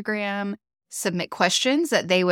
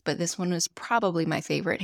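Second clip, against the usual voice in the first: ordinary talk, nothing marked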